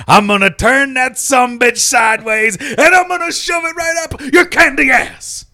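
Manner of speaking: yelled wrestling trash talk; promo shout. Acted. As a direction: bellow it like a heel.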